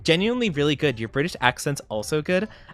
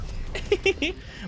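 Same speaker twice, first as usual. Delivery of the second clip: breathy giggle; 5 dB quieter than usual. Real, not acted. Laughs at bonk.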